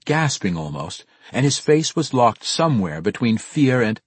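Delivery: ordinary delivery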